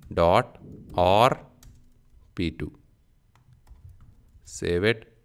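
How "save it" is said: ordinary talk, nothing marked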